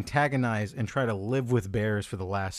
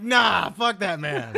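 slow, higher, louder mock brush-off; mock dismissive; 8 semitones higher, 5 dB louder, slower than usual. Friend imitating co-host brushing off the news, slow and scornful. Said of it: "And you're like".

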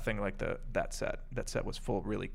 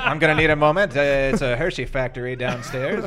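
radio voice; 3 semitones higher, 11 dB louder than usual. Pretend. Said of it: "that radio voice like that".

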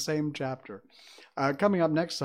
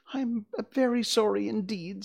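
higher-pitched, worried apology; a touch of fright, lightly acted; frightened girl; 10 semitones higher, 3 dB louder than usual. A narrator's reading. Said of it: "truly frightened".

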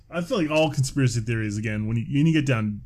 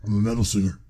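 gravelly Batman voice; 4 semitones lower, faster than usual. Retold or imitated.

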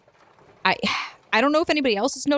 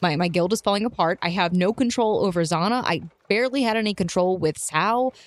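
normal talking voice

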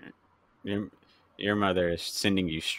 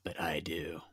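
whispering; 2 semitones lower, 7 dB quieter than usual. Pretend. Whispered retort after movie voice.

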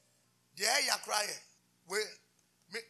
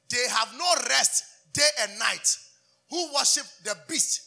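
fiery preaching; 3 semitones higher, 8 dB louder, slower than usual. Acted. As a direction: thunder it at the congregation. Preacher thunders about hell.

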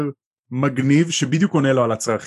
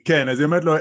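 ordinary delivery

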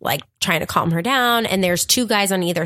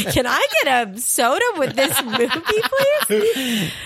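laughing joke; 7 semitones higher than usual. Pretend. Joking request, laughing.

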